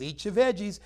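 mother at the table, insisting; nagging mother. Retold or imitated. Mother telling her child at dinner to eat, mock nagging. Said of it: "She says".

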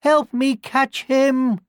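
high, slow call for help; old woman calling desperately for help; old woman's cry. A narrator's reading. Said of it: "cried the old woman".

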